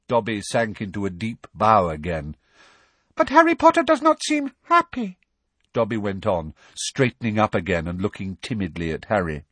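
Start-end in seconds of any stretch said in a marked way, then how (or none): 3.1-5.2 very high, concerned elf voice; a worried little elf peering up; timid elf squeak. Acted. Dobby worrying about Harry, high and timid.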